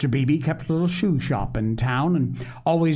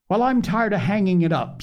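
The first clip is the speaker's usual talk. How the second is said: put-on higher-pitched, vehement little boy; 6 semitones higher than usual. A narrator's reading. Little Davy protesting about hanging up the pail, vehement. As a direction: protest hotly as a small boy. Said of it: "said little Davy vehemently".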